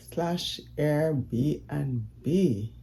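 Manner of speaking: usual speaking voice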